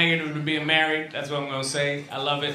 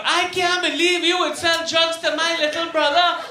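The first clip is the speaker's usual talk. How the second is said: much higher, louder hammed-up outrage; freaked-out wife; 12 semitones higher, 6 dB louder than usual. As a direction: play the furious wife. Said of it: "She freaked out".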